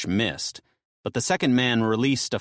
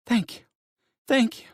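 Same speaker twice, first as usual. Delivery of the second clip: high, tearful thanks; wife's high grateful voice; 13 semitones higher than usual. Acted. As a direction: thank heaven through tears.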